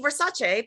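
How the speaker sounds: "Versace" ending in "chay"; correct pronunciation. Shown as a demonstration. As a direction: say "Versace" as ver-SAH-chay. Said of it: "if you say it correctly".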